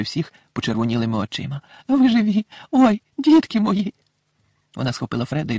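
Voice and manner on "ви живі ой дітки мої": high, worried motherly voice; a fretting mother, breathless with worry; anxious mother. A narrator's reading. Mrs Weasley runs to her husband, high and anxious.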